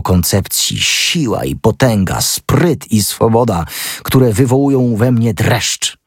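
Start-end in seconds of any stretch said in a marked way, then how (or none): none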